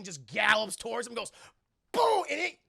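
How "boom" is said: loud, high sudden boom; explosive sound effect; impact noise. Retold or imitated. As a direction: burst it out like a collision. Imitates creature's impact.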